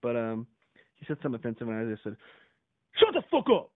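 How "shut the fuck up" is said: explosive high, loud yell; re-enacted full-force retort; retold forceful shout. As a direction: bellow it back, ready for a fight. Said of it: "I said it with the bass and everything".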